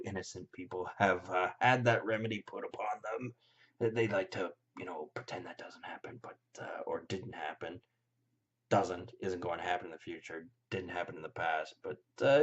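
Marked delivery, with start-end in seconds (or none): 4.8-7.8 hushed conspiratorial whisper. Real, not acted. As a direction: drop to a secretive whisper. Whispered aside about cover-ups.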